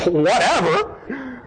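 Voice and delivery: slow, laughing dismissal; dismissive whatever. Retold or imitated. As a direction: stretch it out with a scoff. Acting out his dismissive reply.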